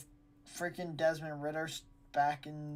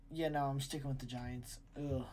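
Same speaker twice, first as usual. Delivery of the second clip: normal talking voice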